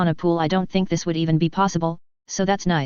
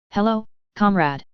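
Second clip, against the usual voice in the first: goofy fake Russian accent greeting; jokey Russian accent, friend to friend; 2 semitones higher than usual. A narrator's reading. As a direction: ham up a bad Russian accent. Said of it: "Jake says in a stupid fake Russian accent".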